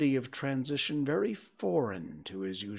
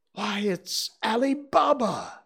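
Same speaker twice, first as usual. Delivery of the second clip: high, louder cry of delight; ecstatic exclamation; 12 semitones higher, 6 dB louder than usual. A narrator's reading. Scrooge spots Ali Baba from his childhood books, ecstatic. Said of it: "Scrooge exclaimed in ecstasy".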